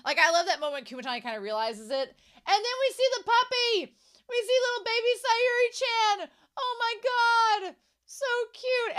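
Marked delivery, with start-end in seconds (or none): 2.1-9.0 very high, loud squeal of delight; excited gushing. Real, not acted. Fan seeing the baby puppy appear, excited high gushing. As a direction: gush loudly, voice way up high.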